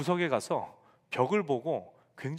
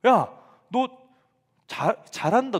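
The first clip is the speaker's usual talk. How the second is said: loud, high, eager praise; cheering on; 6 semitones higher, 7 dB louder, slower than usual. Retold or imitated. Pastor cheering a nervous beginner bowler, loud and eager.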